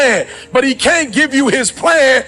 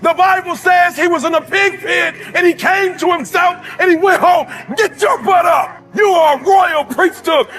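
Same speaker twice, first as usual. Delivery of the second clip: preaching at full force; 5 semitones higher than usual. Acted. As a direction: push every line at the crowd like a preacher.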